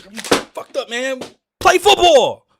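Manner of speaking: high, loud yell building to a shout; furious coach yelling at his players; angry shout. Real, not acted. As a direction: bark the order at the team.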